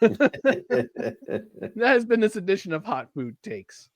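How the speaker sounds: slow, laughing segment close; laughing sign-off. Real, not acted. Host closes the food segment while laughing, slow and amused. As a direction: wrap it up still giggling.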